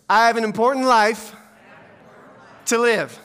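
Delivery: loud line in separate chunks, with a long pause; feed it out piece by piece to be repeated; call-and-response prompt. Shown as a demonstration. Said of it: "ask you to repeat after me".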